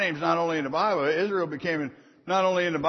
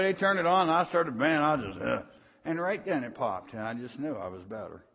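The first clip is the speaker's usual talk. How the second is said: ordinary delivery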